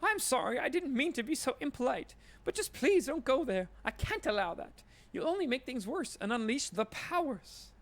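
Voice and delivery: a jittery shop lady begging you to stop; panicked shopkeeper. Acted. Shopkeeper apologises and pleads.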